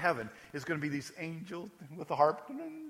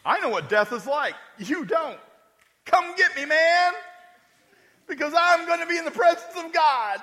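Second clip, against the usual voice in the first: much higher, louder taunting shout; defiant Lazarus; 11 semitones higher, 7 dB louder than usual. Acted. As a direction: dare the chief priests to come. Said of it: "Do you think Lazarus is scared".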